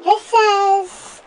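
usual speaking voice

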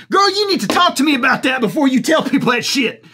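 ordinary talk, nothing marked

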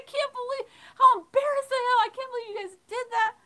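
high-pitched, loud embarrassed scolding, fast at the end; scolding rant. Retold or imitated. Mom chewing out her two small sons, high exasperated scolding. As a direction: chew the kids out in disbelief. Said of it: "and I was yelling".

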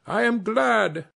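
high, loud, happy call to come ashore; cheerful call. A narrator's reading. Harlequin waving the steamer in, cheerful call. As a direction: beckon them in gladly. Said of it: "as cheerful as you please".

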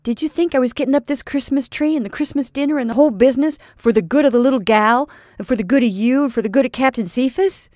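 loud, rising, pressing sea captain; earnest outburst. A narrator's reading. Captain pressing Missus Trimmer with his feelings, loud and urgent. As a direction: pour it all out at her.

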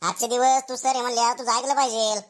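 ordinary talk, nothing marked

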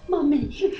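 put-on high, loud childlike "Mummy"; mock mummy. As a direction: mimic a kid calling for mum. Mocking the mum label.

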